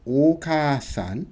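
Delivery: "Okasan." slow and careful, long o; Okasan model word. Shown as a demonstration. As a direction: show how "Okasan." flows as one word. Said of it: "So you're saying".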